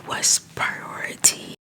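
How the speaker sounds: ordinary delivery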